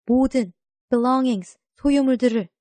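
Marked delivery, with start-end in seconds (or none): none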